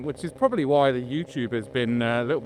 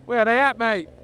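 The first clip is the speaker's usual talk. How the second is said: calling out; 9 semitones higher, 5 dB louder than usual. Real, not acted. Calling to lagging riders.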